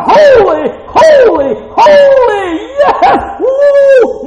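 high, loud, joyful shout of holy; shouted praise. Pretend. Preacher shouting the creatures' praise to his congregation, loud and high. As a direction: cry it out like the angels around the throne. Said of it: "What do you think they're doing? They're going".

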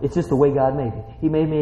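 usual speaking voice

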